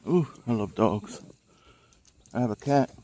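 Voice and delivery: ordinary delivery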